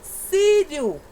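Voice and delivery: "Sírio" high and loud on "Sí"; stress on first syllable. Shown as a demonstration. Teacher demonstrating an early stress to pupils, loud high "Sí". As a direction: punch the opening "Sí" of Sírio. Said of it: "Antepenúltima sílaba".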